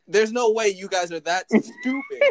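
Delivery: dad cracking up at something dumb; incredulous chuckling; laughing disbelief. Retold or imitated.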